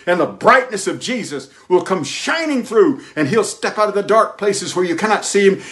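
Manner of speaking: high, ringing preacher's build; preaching climax. Acted. Pastor promising sunlight after rain, soaring preaching. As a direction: lift the crowd with rising fervor.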